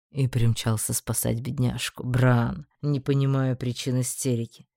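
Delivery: low, dry mockery; mocking low voice. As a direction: make fun of his panic. Einar mocks the magistrate.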